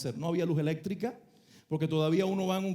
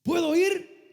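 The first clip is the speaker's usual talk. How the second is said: high, louder eager plea; eager question; 10 semitones higher, 5 dB louder, much slower than usual. Retold or imitated. Peter begs Jesus to let him come, high and louder. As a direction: ask like a kid begging to go.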